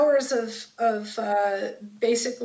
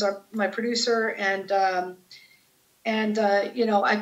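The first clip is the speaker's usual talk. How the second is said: usual speaking voice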